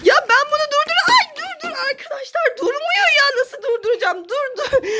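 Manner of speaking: high, frantic begging to stop; high exasperated pleading. Real, not acted. Mother begging the child to stop.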